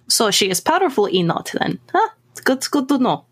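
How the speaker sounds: put-on interested Russian-accented vampire. Newcomer sizing up Elsa's ally, Russian accent. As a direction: take note in a Russian accent. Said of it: "German with a Russian accent".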